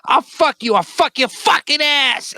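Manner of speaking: crazed Tyson impression. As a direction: screech the threat like a crazed Tyson. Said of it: "He goes".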